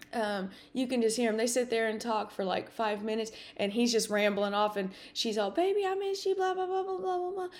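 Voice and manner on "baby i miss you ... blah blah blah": imitating mom gushing on the phone; high, babbling blah-blah voice; high-pitched excited mom. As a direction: prattle high like a thrilled mother. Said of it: "you know, high pitched".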